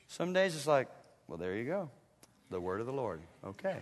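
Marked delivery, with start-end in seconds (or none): 1.3-3.8 low, soft, mock indifference; underwhelmed. Preacher acting out an ordinary day's reading, softly underwhelmed. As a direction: shrug it off quietly, unimpressed. Acts underwhelmed daily Bible reader.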